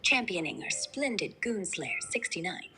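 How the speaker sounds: put-on refined British accent; genteel upper-class English accent; posh Queen's English. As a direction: speak the Queen's English, very proper. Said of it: "Give me the posh style Queen's English now".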